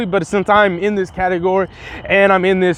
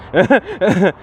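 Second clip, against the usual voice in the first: short chuckle. Real, not acted. Speaker laughing after tripping over his explanation, short real chuckle. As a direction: chuckle at your own stumble.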